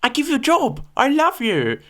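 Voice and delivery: high, loud mock Chinese shopkeeper accent. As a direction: imitate the Chinese shop lady's accent, high-pitched. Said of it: "Should I do an accent?".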